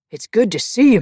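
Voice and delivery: loud, high, joyful hello; booming welcome to an old friend; hearty greeting. Acted.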